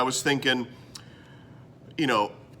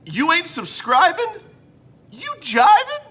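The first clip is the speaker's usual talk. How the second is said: much higher, louder slogan with rising ends; jive slogan; 6 dB louder than usual. Acted. Host announces the motto of the episode, hammed-up jive shout. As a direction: belt the catchphrase as a hammed-up hype man. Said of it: "today's motto of the episode".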